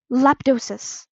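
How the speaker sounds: loud, malicious incantation; spell-casting cry. A narrator's reading. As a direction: throw the hex with malice. Spell cast with malicious intent.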